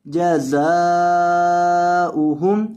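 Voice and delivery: जज़ाउहुम with जा stretched to three alifs; long ja. Shown as a demonstration. Teacher demonstrating to students, जा in जज़ाउहुम drawn long. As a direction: hold the जा of जज़ाउहुम long and slow. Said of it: "जा को तीन अलिफ के बराबर लंबा करेंगे".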